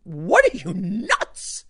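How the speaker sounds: mock incredulous scold; incredulous quote. Scolding a confused bystander, mock incredulous jab. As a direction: toss off a disbelieving jab.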